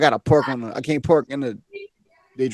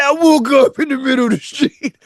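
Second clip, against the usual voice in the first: imitating the old man; 11 semitones higher, 5 dB louder than usual. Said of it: "He said".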